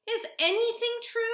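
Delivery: very high, loud, desperate question; upset, high-pitched challenge; distraught question. Acted. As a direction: cry out in disbelief. Girl distraught at grandmother's lies.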